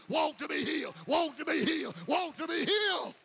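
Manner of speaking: loud, repeated chant of walk to be healed; shouted refrain. Acted. Preacher to congregation, loud repeated chant. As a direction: drive the refrain home.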